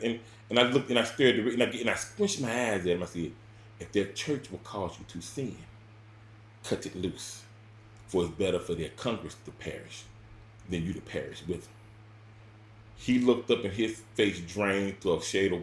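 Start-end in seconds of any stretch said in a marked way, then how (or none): 3.8-11.7 quiet scripture quote. Retold or imitated. Quoting scripture at the men.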